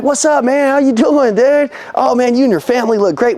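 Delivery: high, upbeat buddy greeting; talking like friends. Acted. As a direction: greet a customer like an old pal. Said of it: "we'd be talking like friends".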